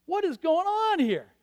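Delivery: loud, high sermon build; preaching at full force. Acted. Preacher booming the Elijah story to the congregation. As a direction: drive the story home from the pulpit.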